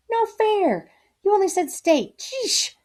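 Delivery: high, whiny complaint; whiny protest. A narrator's reading. Boy protests the changed question, high and whiny. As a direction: squeak out the unfairness. Narrator protests in his head.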